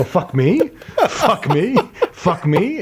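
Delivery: laughing. Retold or imitated. Retold self-mutter, laughing.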